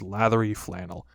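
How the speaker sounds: plain reading voice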